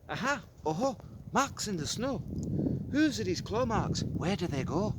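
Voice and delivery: put-on giddy kid spotting marks; thrilled child. Child finds claw marks.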